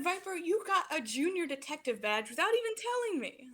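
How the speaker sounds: high, animated scolding; mock-indignant mom. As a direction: exclaim it in pretend outrage. Mom playfully scolds her child.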